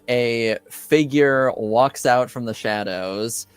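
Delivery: usual speaking voice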